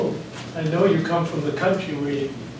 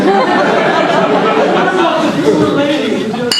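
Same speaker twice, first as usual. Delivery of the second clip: indignant laughing outburst; 11 semitones higher, 9 dB louder, slower than usual. Acted. Indignant improv rant.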